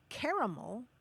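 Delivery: caramel as CARE-uh-mul; three-syllable caramel, weak ending. Shown as a demonstration. Host listing US variants for caller, caramel as CARE-uh-mul. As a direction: say caramel with EH first and a swallowed last vowel. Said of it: "some people say".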